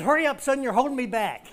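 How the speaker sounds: high, loud laughing urge to hurry; urging on, laughing. Retold or imitated. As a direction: laugh as you push him forward. Dad laughing, urging son on.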